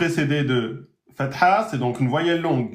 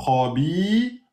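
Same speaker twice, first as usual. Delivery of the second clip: ordinary talk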